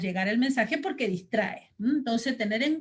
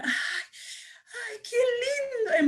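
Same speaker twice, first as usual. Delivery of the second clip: much higher, tearful delighted cry; squealing with delight, much higher; delighted gush; 13 semitones higher, much slower than usual. Shown as a demonstration. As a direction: gush over something lovely. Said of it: "puedo mostrar".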